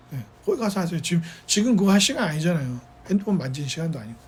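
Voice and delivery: low, soft aside of rebuke; real quiet reprimand. Real, not acted. Preacher tells a student to put the phone away, quiet reprimand. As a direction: drop the voice and admonish a distracted listener. 설교 중 딴짓하는 학생 훈계.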